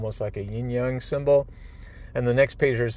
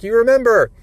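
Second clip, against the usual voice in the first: very high, loud burst of joy; he can't believe they remember; loud, high tearful delight; overjoyed; 12 semitones higher, 12 dB louder than usual. Acted. Son's joy at parents remembering.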